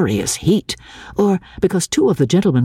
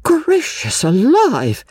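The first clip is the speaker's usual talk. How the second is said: high, slow exclamation of astonishment; shocked cry; 10 semitones higher, slower than usual. A narrator's reading. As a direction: cry out high, scandalised and drawing it out. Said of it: "cried Missus Honeychurch".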